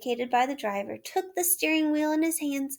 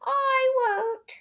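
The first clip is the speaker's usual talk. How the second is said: washerwoman voice; 13 semitones higher than usual. A narrator's reading. Toad as washerwoman.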